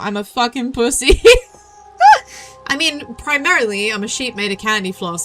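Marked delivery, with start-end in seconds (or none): 2.0-2.6 high laugh. Real, not acted. Laughing at own remark.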